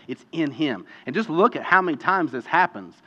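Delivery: ordinary delivery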